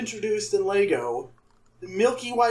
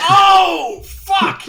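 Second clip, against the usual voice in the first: much louder, higher burst of sudden realisation; 11 semitones higher, 11 dB louder than usual. Real, not acted. Corrected by co-host on which show the quote is from, loud realisation. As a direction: blurt it out, high and loud.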